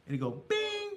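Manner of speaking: "bing" jumped way up high like a chime; playful bing. Acted. Telling viewers the subscribe button chimes, chirped high. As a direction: chirp the word "bing" like a notification ding.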